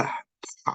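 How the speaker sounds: "p" with a strong puff of air, no voice; aspirated p. Shown as a demonstration. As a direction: release "p" as a breathy burst. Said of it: "aspirated p".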